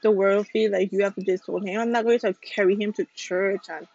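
normal talking voice

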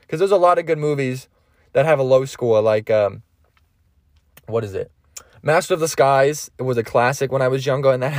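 ordinary talk, nothing marked

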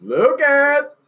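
high loud call across the house. Real, not acted. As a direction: call him from far away. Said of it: "Call him".

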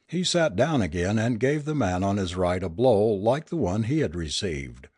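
plain reading voice

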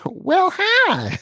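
very high drawn-out cat voice; high-pitched cat impersonation; talking cat. Retold or imitated. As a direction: mimic a meowing cat saying hello. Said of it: "video that's going around of the cat".

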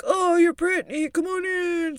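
high, loud party-host cheer; imitated excited welcome. Partygoers welcoming her over intercom.

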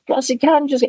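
high, excited announcer imitation; imitated mic announcement. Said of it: "runs on the mic and goes".

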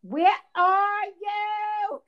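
high, loud, stretched-out call. Retold or imitated. Mother at open door seeks fallen daughter, calling out. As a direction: call slowly to someone out of sight. Mother calls into the dark driveway.

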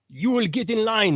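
a hint of a stern German command; mock German order. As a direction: bark it like a mock German officer, lightly. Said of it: "the German approach of".

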